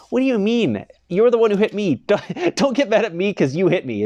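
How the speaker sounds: playfully outraged what-do-you-mean; mock exasperated retort. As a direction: throw it back at them, incredulous. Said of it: "I'm like".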